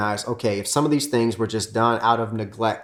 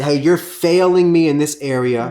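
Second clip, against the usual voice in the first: louder, pointed accusation; accusing; 4 semitones higher, 6 dB louder than usual. Retold or imitated. Husband blaming his wife, loud accusing tone. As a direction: blame her with force. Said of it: "I'm saying that".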